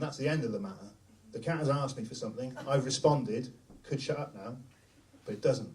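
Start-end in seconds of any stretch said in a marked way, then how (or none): none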